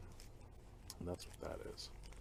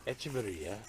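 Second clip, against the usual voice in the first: usual speaking voice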